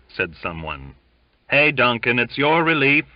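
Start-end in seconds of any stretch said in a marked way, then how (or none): 1.5-3.0 loud call to a sleeper; shouting. A narrator's reading. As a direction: yell it across the room. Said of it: "shouted three or four together".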